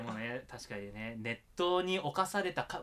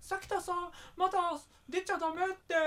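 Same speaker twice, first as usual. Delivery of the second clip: a much higher, coaxing futon voice; be the futon begging him to stay in bed; talking futon; 14 semitones higher, faster than usual. Pretend. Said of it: "布団が僕に話しかけてくるんですよ".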